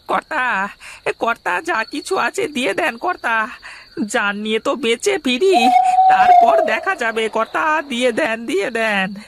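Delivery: wheedling henchman. Acted. Henchman urges landlord to hand over.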